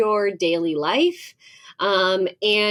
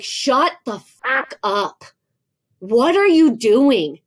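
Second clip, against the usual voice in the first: slow, drawn-out retold snap back; a half-playful angry retort; mock snap; 4 semitones higher, 5 dB louder, slower than usual. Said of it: "snap back and I will say mean things".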